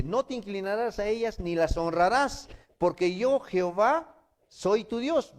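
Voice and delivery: loud, high preaching; preaching at full force. Acted. Preacher thundering the commandment to the congregation.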